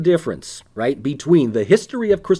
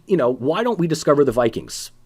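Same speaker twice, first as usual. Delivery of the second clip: ordinary talk, nothing marked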